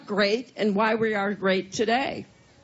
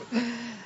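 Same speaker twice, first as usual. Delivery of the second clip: short dry cough; chesty cough; 7 dB quieter than usual. Real, not acted. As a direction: cough through the speech. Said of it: "she had quite a coughing fit".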